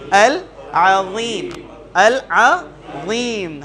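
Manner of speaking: العظیم drawn out very slowly, each letter clear; slow demonstration of العظیم; careful tajweed reading. Shown as a demonstration. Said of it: "واو کو کس طرح".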